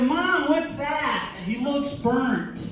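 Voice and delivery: slow, high mock child's question; hammed-up child voice, slow and high; little kid voice. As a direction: play the innocent little kid, drawn out. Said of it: "this little kid pointed at my mustache".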